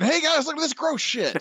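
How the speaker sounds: very high, far-off voice for Ennis; mock Garth Ennis. [name] mocking Garth Ennis in a very high squeaky voice. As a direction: squeak it out like a gleeful gross-out writer. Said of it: "There's a lot of Garth Ennis".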